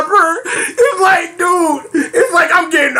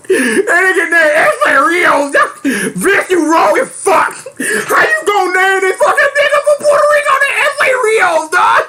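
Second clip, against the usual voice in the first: real helpless disbelief; incredulous laughing rant; 5 semitones higher than usual. Real, not acted. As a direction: voice climbing to a high squeal. Cracking up in disbelief at name.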